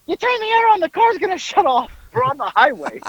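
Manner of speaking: high-pitched frantic imitation; mock a man panicking in a high voice; Howard losing it. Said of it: "Howard loses it".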